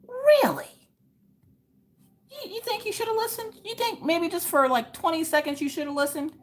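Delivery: high, incredulous mocking voice; high sarcastic "really?"; mock disbelief. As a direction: sarcastically echo her regret, way up high. Mocking the woman who ignored advice.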